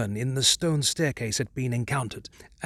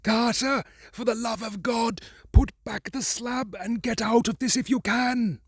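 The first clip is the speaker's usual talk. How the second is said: much higher, frantic urgent pleading; begging his friend to flee now; wild consternation; 10 semitones higher than usual. Acted. Warren urging Carter to escape, wildly frightened. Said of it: "in a pitch of wilder consternation".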